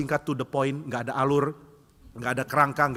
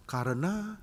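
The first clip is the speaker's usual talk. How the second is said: soft, trailing hesitation; acted lost-for-words, higher and softer; running out of reasons; 4 semitones higher, 6 dB quieter, much slower than usual. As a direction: start a reason and stall. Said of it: "dia mulai kehilangan alasan".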